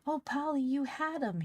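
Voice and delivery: high, loud breathless cry of news; excited scream. Acted. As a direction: burst in shouting the good news. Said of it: "he screamed, all out of breath".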